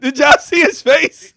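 high, slow, laughing squeal; cracking up mid-question. Real, not acted. Still laughing at his face.

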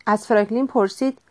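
ordinary delivery

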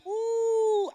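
high, loud, drawn-out ooh; excited whoop. Retold or imitated. Believer crossing the Jordan, an excited whooping ooh. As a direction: whoop it out on one long high note. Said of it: "two groups of people. One that was like".

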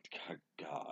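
soft, low dreading God, God; hushed despairing mutter. Retold or imitated. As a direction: mutter it under your breath in dread. Dreading drunk roommates arriving.

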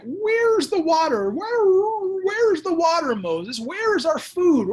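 high, loud whiny complaining; whining Israelites. Retold or imitated. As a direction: whine like a hungry crowd. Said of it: "similar cousin of word where it's like".

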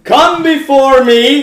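slow, high booming command; booming yell. Acted. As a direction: call out like a god demanding worship. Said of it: "I'm gonna yell".

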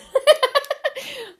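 high-pitched burst of laughter; high cackle. Real, not acted. Vlogger amused by Destiny's Child on caller ID, cackling. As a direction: cackle in real disbelief.

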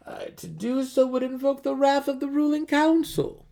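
a mercenary wriggling out of a request; Jarlaxle, evasive mercenary. Acted.

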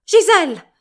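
high, very loud shriek of alarm. A narrator's reading. Mother shrieking for her collapsing daughter, very loud and high. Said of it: "strillò".

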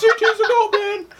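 laughing hype. Real, not acted. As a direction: squeal it out through laughter. Laughing hype about a favourite.

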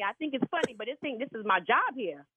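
ordinary delivery

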